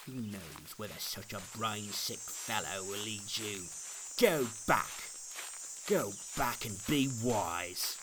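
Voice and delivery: a light sneer at the crazed fellow; scornful Obstinate. A narrator's reading. Obstinate scolds Pliable.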